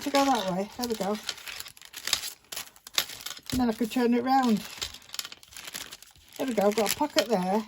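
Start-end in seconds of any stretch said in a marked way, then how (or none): none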